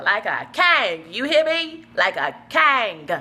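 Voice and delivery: "king" stretched slow and loud; drawn-out, loud stress on "king"; emphatic KING. Acted. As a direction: proclaim "king" with a long, punched vowel. Emphatic repeats about uncle's lavish life.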